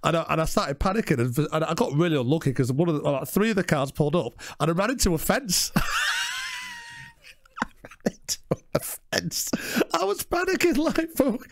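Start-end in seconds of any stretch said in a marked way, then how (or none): 5.8-11.4 real high, squeaky laugh-talk; cracking up at your own mishap; helpless laughter. Real, not acted. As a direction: barely get the words out for laughing.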